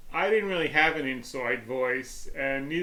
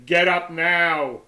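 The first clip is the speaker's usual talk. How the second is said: slow, louder stern command; stern parent calling; 4 semitones higher, 7 dB louder, much slower than usual. Retold or imitated. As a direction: order the kid up. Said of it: "in a loud voice".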